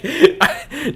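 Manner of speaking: ordinary delivery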